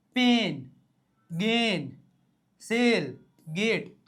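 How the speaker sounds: પેન, ગેન, સેલ, ગેટ one at a time, vowel stretched; e sound demonstrated. Shown as a demonstration. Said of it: "ઉચ્ચાર એ કઈ રીતના થાય?". (પેન, ગેન માં એ લંબાવીને)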